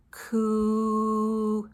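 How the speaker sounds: "Coo" with a long, level oo; k gliding into a drawn-out oo in "Coo"; stretched qu sound. Shown as a demonstration. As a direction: hold "Coo" as one steady "kwooo". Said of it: "Q, U together says this".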